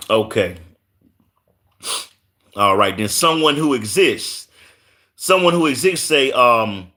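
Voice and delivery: usual speaking voice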